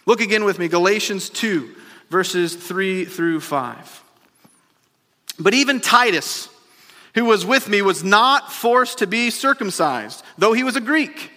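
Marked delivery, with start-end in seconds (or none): 5.4-11.3 preached scripture reading. Real, not acted. Reads scripture with raised voice.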